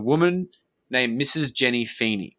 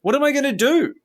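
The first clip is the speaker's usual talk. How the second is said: much higher, louder panicked question; desperate plea; 13 semitones higher, 5 dB louder than usual. Retold or imitated. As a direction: ask like a cornered man begging for help. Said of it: "he said to me".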